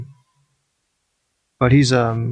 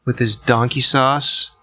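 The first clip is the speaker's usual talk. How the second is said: ordinary delivery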